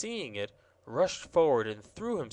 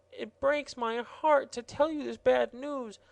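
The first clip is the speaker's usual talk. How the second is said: mock-tearful gardener, much higher; weeping; 10 semitones higher than usual. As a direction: sob out the bad news. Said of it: "beginning to weep real tears".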